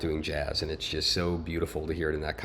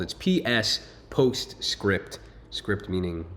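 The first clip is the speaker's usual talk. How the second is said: slow explanation; much slower than usual. Shown as a demonstration.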